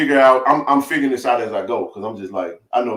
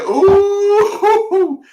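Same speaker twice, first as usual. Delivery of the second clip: much higher, stretched ooh of dread; dismayed ooh; 4 dB louder than usual. Real, not acted. Seeing the GPS arrival time: high pained ooh. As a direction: react to bad news with a wince.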